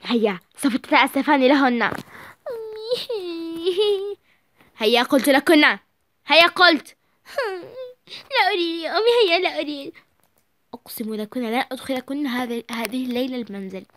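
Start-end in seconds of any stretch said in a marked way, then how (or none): 0.0-6.9 louder, insistent command; stern order. Acted. Mother ordering daughters to apologise, stern. 8.1-9.9 teary whine; whiny refusal. Acted. Daughter refusing to apologise to mother, whining.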